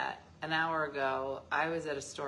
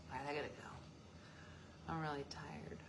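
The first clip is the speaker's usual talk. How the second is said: whisper; 6 semitones lower, 18 dB quieter, much faster than usual. Real, not acted. Whispers tiredness while signing off.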